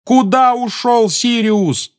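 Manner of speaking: high, loud, slow yell. Acted. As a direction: yell after him. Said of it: "завопил Гарри".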